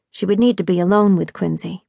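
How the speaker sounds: ordinary delivery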